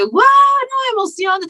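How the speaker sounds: high, thrilled whoop; excited shout. Retold or imitated. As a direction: squeal with delight at the news. Joy at getting the role.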